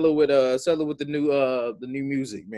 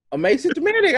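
dramatic exclaim; 5 dB louder than usual. Acted.

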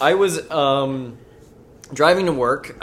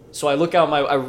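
ordinary talk, nothing marked

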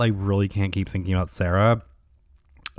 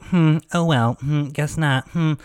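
high, sing-song dismissive hums, imitating; mock the reporter's brush-off with lazy hums; mocking condescension; 5 semitones higher, slower than usual. Mocking Sarah's condescending brush-off, high and dismissive. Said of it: "she talked down to me".

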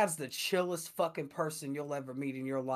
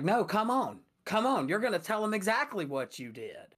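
higher, louder stern mom voice; imitating a mom marching her kid back; mom scolding; 6 semitones higher, 3 dB louder than usual. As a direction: drag the kid in, no nonsense. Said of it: "she was like".

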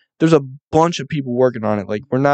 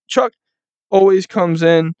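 ordinary talk, nothing marked

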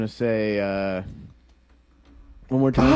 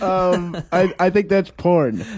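normal talking voice

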